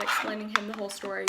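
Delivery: ordinary talk, nothing marked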